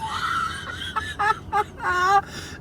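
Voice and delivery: helpless tearful cackle; a real laughing fit that breaks into tears; howling laugh. Real, not acted. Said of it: "I'm in tears now, too".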